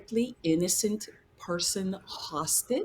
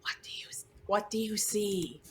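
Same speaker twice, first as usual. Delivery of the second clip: hushed, breathy question; whisper; 3 semitones higher, 5 dB quieter than usual. Acted. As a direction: whisper it so no one hears.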